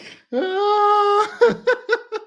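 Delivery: high, loud cackle close to tears; shrieking laugh. Real, not acted. Cackling at her own rant, high and loud. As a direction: cackle till it almost breaks.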